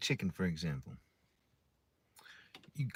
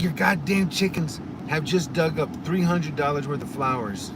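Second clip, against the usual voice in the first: angry neighbor; 5 semitones higher, 6 dB louder than usual. Retold or imitated. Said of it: "he's like".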